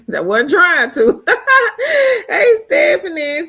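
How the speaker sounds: embarrassed laughing fit after calling herself sexy; real laughter. Real, not acted. Laughs at own sexy remark.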